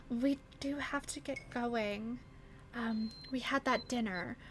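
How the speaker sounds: softly murmured aside; quiet aside to husband. Acted. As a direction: mom quietly reminding husband of dinner. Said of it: "she turns to her husband".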